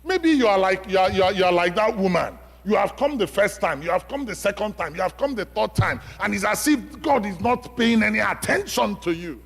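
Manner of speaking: loud, high storytelling sermon voice; impassioned preaching. Acted. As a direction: drive the widow's story home to the church. Preacher retells widow parable, loud.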